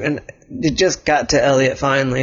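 plain reading voice

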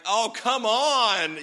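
slow, drawn-out exasperated groan at a slow driver; impatient driver fed up with the car ahead; exasperated oh come on. Retold or imitated. Pastor mocking his own road rage, drawn-out exasperation. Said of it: "my typical thing where I'm like".

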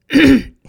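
loud throat clear before a word. Real, not acted. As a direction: clear your throat hard, then go on. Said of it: "excuse the throat clearing".

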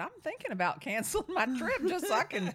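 loud, high, laughing through the line; teasing through laughter; laughing joke. Retold or imitated. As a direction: crack up as you joke. Joking reply while laughing.

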